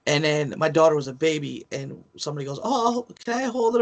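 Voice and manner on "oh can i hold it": high, eager ask of a stranger; raised voice stranger. Retold or imitated. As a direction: ask sweetly to hold the baby. Stranger asks to hold baby.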